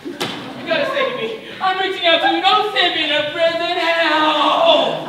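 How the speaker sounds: high, loud begging; hammed-up desperate appeal; desperate plea. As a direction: beg for rescue, building to a cry. Begs to be saved from prison.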